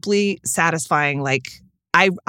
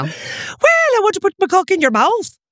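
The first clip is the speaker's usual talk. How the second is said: hammed-up Irish brogue; 13 semitones higher than usual. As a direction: ham up the Irish lover from a romance novel. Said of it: "Like, like that".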